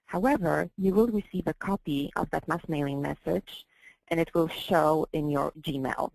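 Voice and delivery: usual speaking voice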